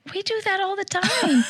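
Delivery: high mocking singsong; mock her breezy excuse; high mocking imitation of mom. Mimicking mom's excuse.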